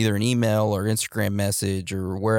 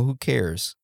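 ordinary talk

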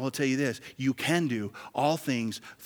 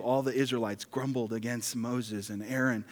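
usual speaking voice

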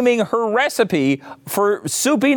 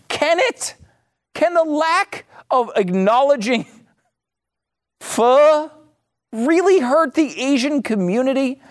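high-pitched, sceptical echo of the claim; incredulous questioning; 7 semitones higher than usual. Real, not acted. Host challenging the claim about hurting a community, incredulous. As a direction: throw the quote back in high disbelief.

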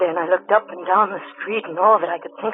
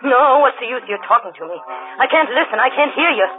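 anguished outburst; 7 semitones higher than usual. Acted. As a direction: push his words away, distraught. Woman confronts man at cliff.

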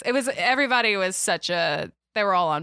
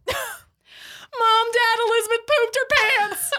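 high, loud scream imitating a tattling sister; 5 dB louder, slower than usual. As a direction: scream for the parents to tell on your sister. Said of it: "to scream".

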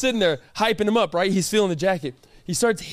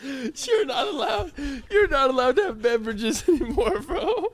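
laughing through the scolding; giggling while telling him off; 5 semitones higher than usual. Real, not acted. Friend laughing as she scolds him about drinks.

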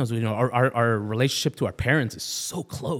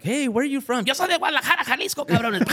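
much higher, fierce mock mom; hammed-up scary Mexican mother; tough mom; 11 semitones higher than usual. Mom answering where she's from, high mock fierce.

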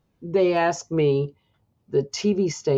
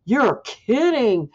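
stunned disbelief; 7 semitones higher, 4 dB louder, slower than usual. Retold or imitated. Reacting to mom's news.

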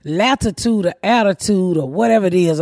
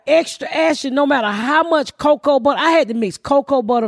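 ordinary talk

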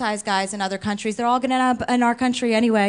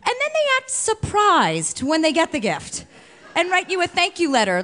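ordinary talk

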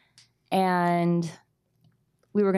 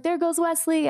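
high, excited play-by-play call, much higher; sports announcer; 11 semitones higher than usual. Retold or imitated. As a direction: hype it like a stadium announcer for a toddler on a bike. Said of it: "And I was narrating".

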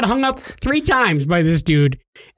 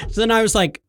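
normal talking voice

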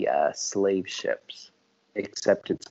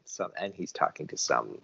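normal talking voice

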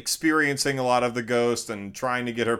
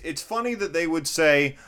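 ordinary delivery

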